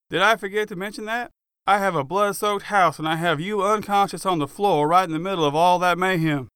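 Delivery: mock him bitterly, building anger; half screaming. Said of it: "half screaming at me".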